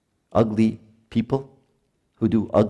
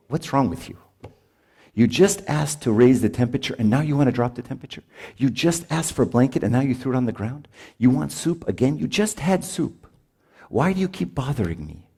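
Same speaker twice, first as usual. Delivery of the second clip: acted exasperation, higher pitched scolding; pitched-up, incredulous parental complaint; exasperated parent; 5 semitones higher than usual. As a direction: scold a demanding child in mock annoyance.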